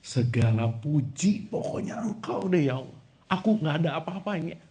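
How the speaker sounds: hushed mock humility; mock humble prayer. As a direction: mumble a careless praise. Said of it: "Coba, Bu, Pak".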